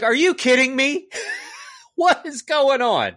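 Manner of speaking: high, loud, laughing incredulity; exasperated disbelief. Acted. Reviewer outraged at walking on broken leg, exasperated disbelief. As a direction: cry out in comic outrage.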